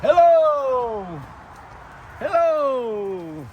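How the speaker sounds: high, drawn-out parrot-style hello. Pretend. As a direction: greet them like a parrot would. Greeting birds in bird voice.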